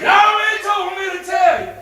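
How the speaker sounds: high, ringing shout; preacher's ringing proclamation. Shown as a demonstration. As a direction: call it out to grab the room. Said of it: "It gets your attention when you talk like that".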